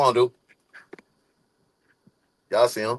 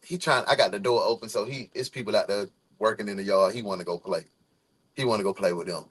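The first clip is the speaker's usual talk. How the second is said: ordinary delivery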